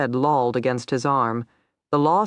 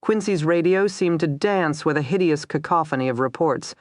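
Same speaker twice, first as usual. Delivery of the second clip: usual speaking voice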